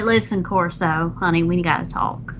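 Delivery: low southern sweet-talk; southern accent. Acted. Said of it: "I have to speak in my southern accent".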